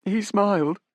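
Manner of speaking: a touch of disbelief, pitched high. Acted. Young woman recalls the dying man, in mild disbelief. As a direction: say it as if you can't believe it. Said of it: "she said in disbelief".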